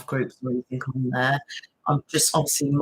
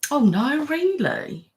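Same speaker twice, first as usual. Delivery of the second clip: sudden higher, dismayed "oh no, really"; surprised concern; 12 semitones higher, 3 dB louder, much slower than usual. Real, not acted. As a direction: react with real dismay to the news. Viewer shares bad news.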